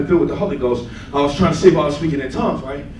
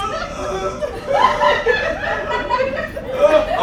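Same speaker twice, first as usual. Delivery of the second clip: long high helpless laugh; high laughter. Real, not acted. Laughing at his own story.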